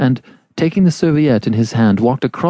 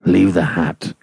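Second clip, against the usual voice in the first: low voice muffled by cloth; 5 semitones lower than usual. Acted. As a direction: speak through a cloth, lower. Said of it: "in a muffled voice".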